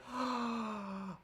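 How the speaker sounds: quiet breathy gasp of wonder; soft awed gasp. Real, not acted. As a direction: gasp softly in amazement. Amazed at sweater working.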